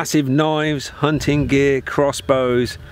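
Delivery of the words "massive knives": usual speaking voice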